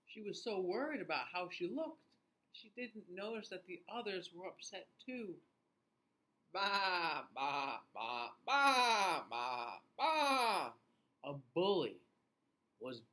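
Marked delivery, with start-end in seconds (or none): 0.1-5.4 story reading. A narrator's reading. Reads story to children. 6.5-10.7 upset bleats. Acted.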